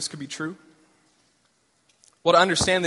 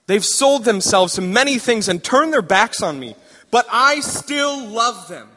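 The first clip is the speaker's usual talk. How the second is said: higher and louder, slowing at the end; impassioned God; 5 semitones higher, 6 dB louder than usual. Acted. God explaining his love for Israel, impassioned. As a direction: plead the love for Israel with passion.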